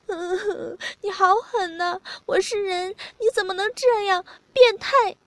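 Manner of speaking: high, crying accusation; tearful reproach. A narrator's reading. Narrator voices the crying partner reproaching them, tearful reproach. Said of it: "他哭了，说".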